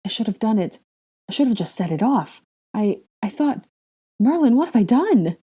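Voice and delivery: upset, faltering then crying out; distraught. Acted. Guilty friend breaking down.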